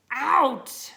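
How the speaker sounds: sudden loud shout of out; burst out. Acted. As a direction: blurt it out loud. Dog bursts out in story.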